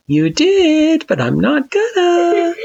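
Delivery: high, teasing sing-song. A narrator's reading. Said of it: "in a sing-song tone".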